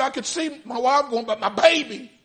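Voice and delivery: high, loud grieving cry; anguished cry. Retold or imitated. Father losing his wife and baby, anguished cry.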